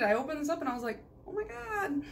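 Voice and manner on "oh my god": high, soft mock squeal; re-enacted thrill, high and hushed; excited retold gasp. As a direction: gush it in a small, high voice. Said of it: "I was so excited".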